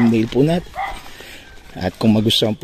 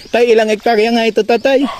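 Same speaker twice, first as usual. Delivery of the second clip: raised, much higher voice; calling out; 12 semitones higher, 4 dB louder than usual. Real, not acted. Vlogger asking the landowner about hectares, calling out high. Calling a question to older man.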